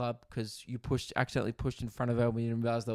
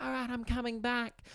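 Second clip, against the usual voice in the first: chirpy high reply; 11 semitones higher, 4 dB quieter than usual. Retold or imitated. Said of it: "I'm like".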